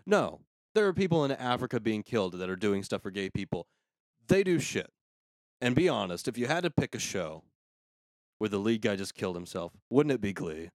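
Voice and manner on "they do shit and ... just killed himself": ordinary delivery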